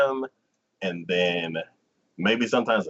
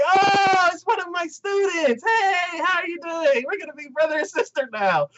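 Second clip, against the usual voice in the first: chirpy teacher. Retold or imitated. Stepsister-to-be teacher greets her student, high and bubbly. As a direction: squeal it out like an overly cheerful teacher.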